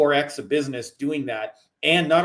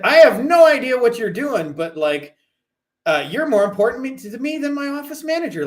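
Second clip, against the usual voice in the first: much higher and louder, gushing and thrilled; excited rave; 10 semitones higher, 5 dB louder than usual. Retold or imitated. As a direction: rave like a client who can't contain himself. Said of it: "He said".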